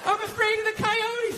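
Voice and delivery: high, slow mock wife voice; wife's excuse. Wife excusing herself from the trash, imitated high voice. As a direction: imitate a wife pleading fear, drawn out.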